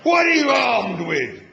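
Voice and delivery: roaring in disbelief. A narrator's reading. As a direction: bellow it, unable to believe him. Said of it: "roared the tank in disbelief".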